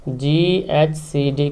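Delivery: very slow, drawn-out letters; spelling out while writing. Real, not acted. Teacher writes the rectangle's name, spelling out slowly. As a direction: stretch each letter as you write it.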